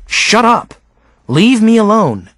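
contained scream of exasperation, pitched well up; imagined scream. A narrator's reading. Boy wishing his tormenting classmates would go away, contained scream. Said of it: "I wanted to scream".

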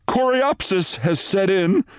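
high, slow, uneasy report; nervous doctor. A narrator's reading. Renshaw nervously telling Mitty of the complication. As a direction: break the bad news nervously. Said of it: "said Renshaw nervously".

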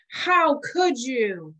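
slow, drawn-out wounded plea; a germ sorrowfully betrayed. Acted. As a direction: plead like a hurt friend. Germs betrayed.

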